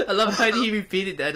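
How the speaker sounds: laughing. Real, not acted. Laughing at friend's repeat.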